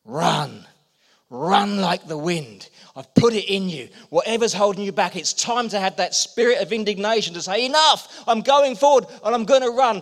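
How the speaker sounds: rally the congregation forward; full-force call to go; rousing preaching. Acted. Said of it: "And he says".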